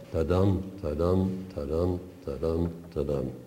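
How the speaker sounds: ta-DUM each time, stress on the second beat; iambic beat. Shown as a demonstration. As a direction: tap out "ta-dum" evenly, weight on "dum". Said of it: "meter five iambic feet".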